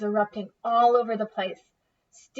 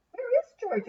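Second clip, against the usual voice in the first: much higher mother's voice; high mama voice; 14 semitones higher than usual. Acted. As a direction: ask like a worried mother. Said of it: "asked Mama Grunt".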